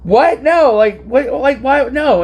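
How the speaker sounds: high, startled protest; flustered disbelief. Retold or imitated. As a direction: blurt it out, caught off guard. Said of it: "And I was like".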